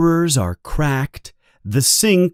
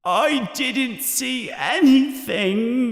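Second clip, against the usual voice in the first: high, slow, wailing denial; a ghost's drawn-out, desperate moan; 13 semitones higher, slower than usual. A narrator's reading. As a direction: wail it desperately, pitched way up. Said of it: "she says in a desperate voice".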